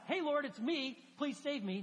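hammed-up chummy request; casual plea. Shown as a demonstration. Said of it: "It's not".

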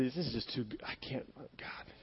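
acted helpless murmur, trailing into breath; hushed, defeated prayer. Preacher voicing a burdened believer to God, hushed.

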